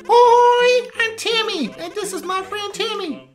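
very high, loud squeaky critter; squeaky creature voice. Pretend. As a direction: squeak it like a tiny excited creature. Voicing the Temmie characters.

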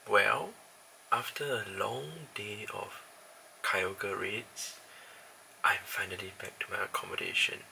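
hushed, near whisper; quiet at night. Real, not acted. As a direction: keep it soft so nobody wakes. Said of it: "I can't talk really loudly because my other roommates are sleeping".

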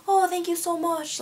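high-pitched, put-on Irish accent; imitated Irish accent. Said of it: "like, in the accent".

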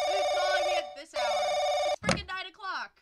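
annoyed yell. Acted. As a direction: bark at the phone. Angry at late call.

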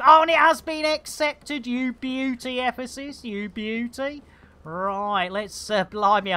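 ordinary talk

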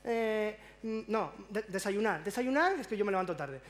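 ordinary talk

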